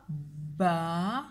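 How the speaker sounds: "Baleine" in three long separate syllables, ba-lei-ne. Shown as a demonstration. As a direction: dictate "Baleine" very slowly, holding L and N. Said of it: "Je répète une dernière fois". (« Baleine » dicté lentement en trois syllabes)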